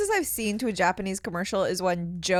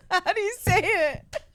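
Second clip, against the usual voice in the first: asked through high laughter; laughing; 11 semitones higher, slower than usual. Real, not acted. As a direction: giggle through the question. Laughing while asking.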